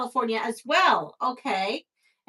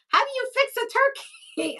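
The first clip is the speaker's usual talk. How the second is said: much higher, incredulous question; baffled friend; 13 semitones higher than usual. Retold or imitated. A Brooklyn friend asking, bewildered and mock-incredulous. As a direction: play the baffled New Yorker.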